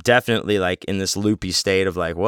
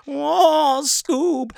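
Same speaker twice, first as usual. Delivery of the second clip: very high, wailing cartoon voice; Shaggy. Actor teasing a tripping kid, imitated high cartoon wail. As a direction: stretch it out in a high cartoon falsetto. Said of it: "he's doing the Shaggy voice".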